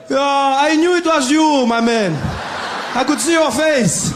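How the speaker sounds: high, loud, slow cheerful guard; loud high slow easygoing guard. Retold or imitated. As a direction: greet him like an old friend. Guard explains loudly.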